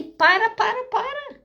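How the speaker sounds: súplica em tom bem agudo, sem gritar; grito interno imitado. Retold or imitated. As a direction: imitar um coração aflito pedindo que parem. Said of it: "começa a gritar meu coração". (grito de súplica encenado, contido)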